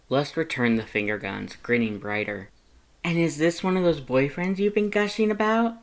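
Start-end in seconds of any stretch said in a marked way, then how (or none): 3.0-5.8 put-on high, louder, bubbly young voice; cheerful Frisk voice. Frisk telling Lust about college, high and bubbly. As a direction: brag happily to a friend.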